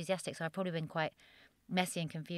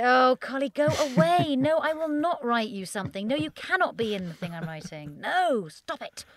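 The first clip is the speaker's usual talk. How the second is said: mock shooing; 6 semitones higher, 7 dB louder than usual. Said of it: "I say".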